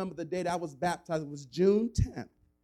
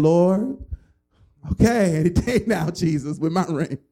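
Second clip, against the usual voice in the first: mock impatient prayer; 9 dB louder than usual. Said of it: "you're like".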